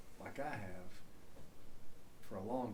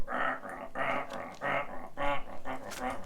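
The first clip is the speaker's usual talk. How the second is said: repeated mechanical rah rah grinding; imitating disk drive noise; 4 semitones higher than usual. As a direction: mimic an old floppy drive. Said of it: "it would go".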